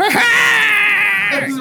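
high, loud screeching goblin cry. Acted. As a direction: let out a falling goblin shriek. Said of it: "this screechy sound".

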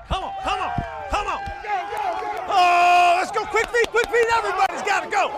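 loud, high coaching yells; shouting. Real, not acted. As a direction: bark drills at the players. Coach yelling drills at practice.

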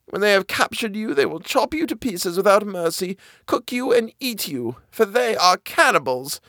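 put-on high, hushed old woman's warning. A narrator's reading. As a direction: whisper the danger like an old granny. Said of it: "replied the old woman".

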